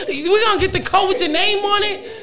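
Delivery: high, laughing, delighted. Retold or imitated. Mom retells agreeing to the coat, laughing. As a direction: say yes to everything, laughing. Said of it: "I'm like".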